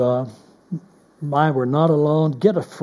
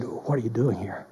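much softer, lower, gentle but pointed; tender but confronting; 5 semitones lower, 9 dB quieter than usual. Shown as a demonstration. God asking Elijah again, soft and tender. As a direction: ask it softly, like a father who already knows. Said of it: "more confrontive, more tender".

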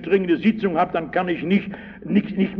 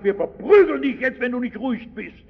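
higher, threatening, rushing at the end; mock threat; 6 semitones higher, faster than usual. Angry crowd threatening the blind beggar, raised menacing voice. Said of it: "die Leute böse und haben gesagt".

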